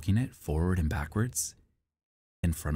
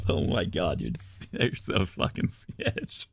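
breathy laughing; slower than usual. Real, not acted. Reacting to chat, laughing.

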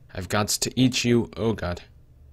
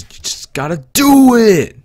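high, loud just do it; Shia LaBeouf yell; 13 semitones higher, 14 dB louder than usual. Retold or imitated. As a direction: scream it motivational style. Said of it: "like the Shia LaBeouf thing".

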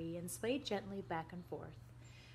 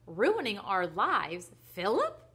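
loud, rising disbelief; incredulous; 2 semitones higher, 9 dB louder, slower than usual. Acted. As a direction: echo the charge in astonishment. Mother disbelieves daughter's accusation.

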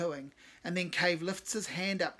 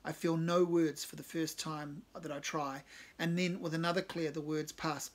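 ordinary delivery, nothing marked

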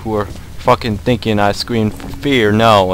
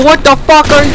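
high, much louder frightened yell; panicked shout; 15 dB louder than usual. Real, not acted. Gamer yelling at the monster attacking him. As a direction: yell at the thing in terror.

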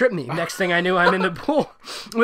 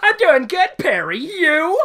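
mock laughing reply; 12 semitones higher, 8 dB louder, slower than usual. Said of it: "I say as I laugh".

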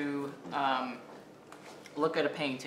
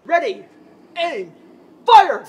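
shouted, much higher and louder; barked firing squad commands; 17 dB louder, slower than usual. Acted. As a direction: bark it like a firing squad officer. Counting down to a launch.

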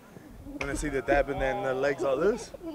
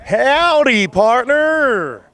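loud, much higher American cowboy howdy; American cowboy accent; 10 semitones higher, 10 dB louder, much slower than usual. Pretend. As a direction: greet like a hammed-up Texan cowboy, American accent. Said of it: "give me your best American accent".

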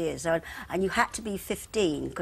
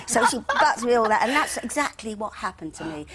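ordinary talk, nothing marked

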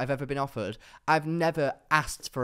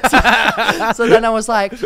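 laughing, louder and higher; 7 semitones higher, 12 dB louder, slower than usual. Real, not acted. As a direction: laugh through it, tickled by the memory. Laughing at his own comeback.

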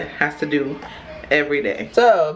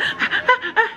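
put-on high, breathy little baby "ah ah ah" with a laugh; imitated baby noise; slower than usual. Mom imitates baby Aiden's excited noise. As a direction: copy the baby's weird excited noise. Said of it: "He like".

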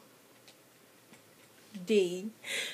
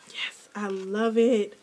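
usual speaking voice

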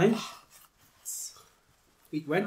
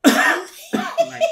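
laughing; 8 dB louder than usual. Real, not acted. Laughing fit after eating spicy.

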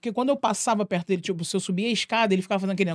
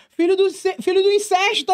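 much higher yelled taunt; passing jeer; 11 semitones higher, 4 dB louder than usual. Retold or imitated. Passing schoolboy yelling the old taunt, much higher. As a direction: yell it while running past.

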